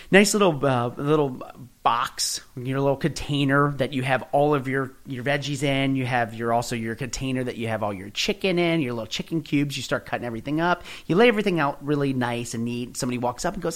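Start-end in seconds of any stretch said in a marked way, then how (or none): none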